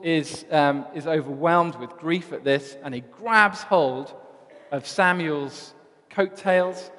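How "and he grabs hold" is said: ordinary delivery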